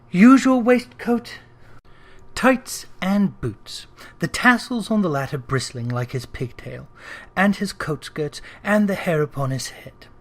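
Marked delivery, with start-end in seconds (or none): none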